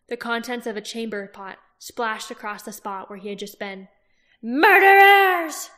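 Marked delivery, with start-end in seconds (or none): 4.4-5.7 very high, very loud, slow scream; shrieked accusation. A narrator's reading. Woman screaming at soldiers from above, very high and loud. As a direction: scream it from the window. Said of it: "shouted a woman".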